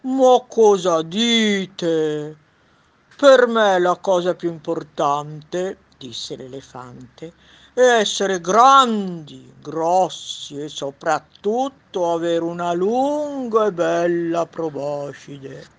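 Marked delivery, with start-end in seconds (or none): 0.0-5.8 slow, booming loud elephant. Acted. Elephant voice in read story. 7.7-15.7 slow, booming loud elephant. Acted. Elephant voice in read story.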